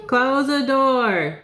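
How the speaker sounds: high, drawn-out exasperated call; exasperated order. Real, not acted. Reader to someone interrupting the session, exasperated.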